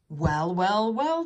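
loud, rising teasing triple; gloating storybook tease; chuckling tease. A narrator's reading. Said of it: "chuckled Edward".

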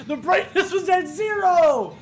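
high, laughing and drawn out slowly; cracking up while saying it; laughing disbelief. Real, not acted. Gamer laughs at the blinding screen, high and laughing. Realizes brightness setting was zero.

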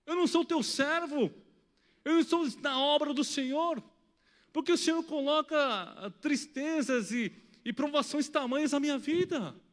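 loud, high anguished plea; inner cry to God. Retold or imitated. Father crying out to God at his daughter's pain, loud plea. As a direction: cry out to the Lord in desperation. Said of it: "Eu também gritei por dentro".

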